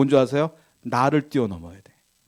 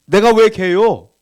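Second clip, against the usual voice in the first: slow, loud, offended protest; indignant retort; 5 semitones higher, 7 dB louder, slower than usual. Retold or imitated. Woman protesting to Jesus, mock indignant. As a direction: push back as if insulted. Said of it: "이렇게 따지는 거예요".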